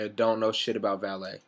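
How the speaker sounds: normal talking voice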